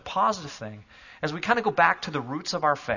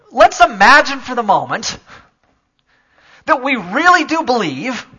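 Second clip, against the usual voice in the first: raised, loud pulpit voice; fervent preaching; 7 semitones higher, 12 dB louder than usual. Acted. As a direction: preach it out with full conviction. Preaching at full force.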